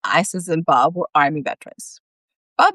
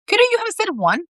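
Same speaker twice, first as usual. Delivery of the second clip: high exasperated question. A narrator's reading. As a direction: pitch up in disbelief. Isis exasperated.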